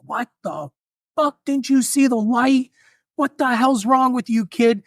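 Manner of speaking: high, hammed-up yell at a reckless kid; angry yell. Old driver yelling at the teen who nearly hit him, high mock yell. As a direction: bark it out like a furious old driver. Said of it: "And he yells like".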